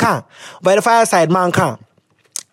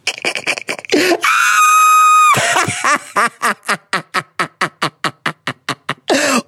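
long cackle with wheezing pulses; big real laugh running on and on; uncontrollable laughter; 5 semitones higher than usual. Real, not acted. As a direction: laugh until out of air. Cracks up.

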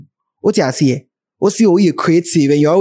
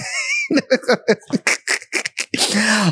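laughing; 7 semitones higher, 5 dB quieter than usual. Real, not acted. Laughing at own mock quote.

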